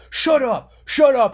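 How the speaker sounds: mimicking streamers shouting at Cyrax; retold yelling. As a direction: imitate the guys yelling him down. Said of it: "while he's yelling at him".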